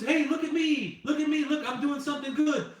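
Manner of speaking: high, loud show-off shouting; mock a do-gooder yelling for everyone's attention; imitating a loud, high-pitched brag; mock attention-seeking scream. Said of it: "it screams".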